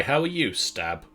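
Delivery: ordinary delivery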